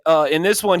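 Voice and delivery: usual speaking voice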